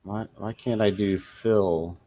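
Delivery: usual speaking voice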